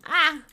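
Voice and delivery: short, loud "ah!"; sudden high outburst of frustration; frustrated yelp. Real, not acted. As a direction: cry out at your own slip. Frustrated at mixing up lyrics.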